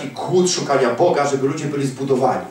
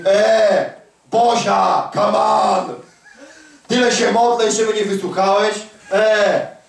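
mock whiny prayer; 6 semitones higher, slower than usual. Woman nagging God in prayer.

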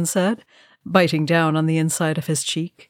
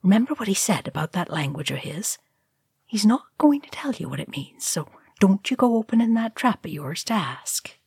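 hushed, dropping to a whisper; shushing Sam; 3 semitones higher, faster than usual. A narrator's reading. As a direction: hush the youngster sharply under your breath. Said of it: "Sam shushed him".

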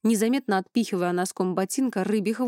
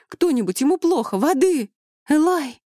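loud, high-pitched panicked calling; alarmed cry for help; 8 semitones higher, 8 dB louder than usual. A narrator's reading. Vivianna calls for water for her collapsing husband, alarmed cry. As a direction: shout frantically for someone to come.

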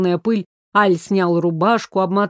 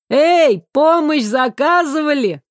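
loud, high cheerful hail; calling out; 6 semitones higher, 4 dB louder than usual. Acted. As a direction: call across the hall. Newcomers call out cheerfully.

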